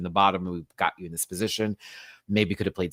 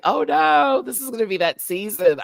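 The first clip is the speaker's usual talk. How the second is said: mock dread; 8 dB louder than usual. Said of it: "I was like".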